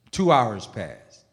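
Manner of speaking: usual speaking voice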